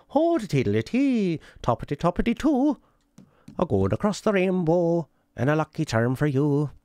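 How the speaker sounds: high, slow singsong stage-Irish lilt, hammed up; mock Irish accent. Gamer mocking how people fake an Irish accent, high singsong lilt. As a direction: parody a cheesy Irish accent. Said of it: "what people do for an Irish accent is like".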